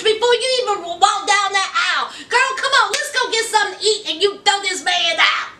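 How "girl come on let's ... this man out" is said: normal talking voice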